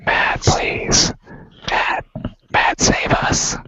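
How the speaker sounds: urgent stage whisper; pleading whisper. Pretend. Begging the host to save the show, whispered. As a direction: whisper a mock-desperate plea for rescue. Whispered plea to the show's leader.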